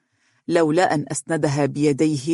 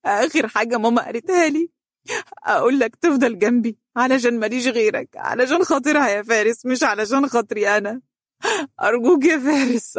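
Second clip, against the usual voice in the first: much higher, gasping broken pleas; breaking down; 8 semitones higher than usual. A narrator's reading. Orphaned daughter repeating her mother's last wish, breaking down. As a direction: fall apart while begging. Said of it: "وشهقت بقوة أكبر وهي تقول بانهيار".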